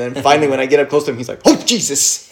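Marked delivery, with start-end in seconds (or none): 1.5-2.3 startled dog, higher voice; mock jolt of fright, as if caught unawares; freaked out. Deaf dog startled by its owner up close, mock freak-out. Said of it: "just freaked the fuck out".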